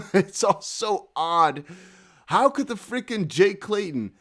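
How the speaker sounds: drawn-out, incredulous laughing delivery; laugh, then stretch the words out slowly; real amused disbelief. Real, not acted.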